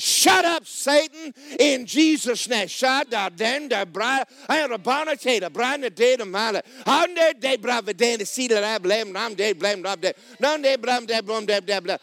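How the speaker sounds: high, loud rebuke pouring into tongues; warfare prayer. Acted. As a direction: shout the devil down in rapid-fire prayer. Acting out battle prayer against Satan.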